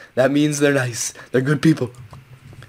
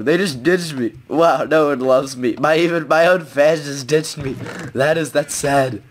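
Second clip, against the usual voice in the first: mock sad; slower than usual. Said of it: "I'm crying right now".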